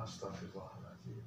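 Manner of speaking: soft, low murmured prayer; under the breath. Retold or imitated. Praying under his breath in shock. (doa lirih di bawah napas)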